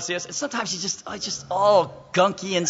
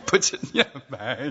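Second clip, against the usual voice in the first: normal talking voice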